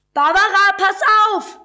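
high, loud cry of alarm; screamed warning. A narrator's reading. Lucia warning Barbara in the stampede, a high shout. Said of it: "schrie Lucia".

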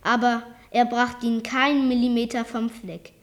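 ordinary delivery, nothing marked

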